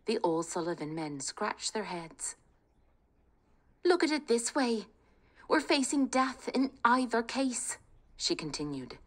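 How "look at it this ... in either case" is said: put-on higher, urgent young Kate; rally the family, high and eager; spirited young woman. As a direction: bold daughter talking her family round. Kate voiced.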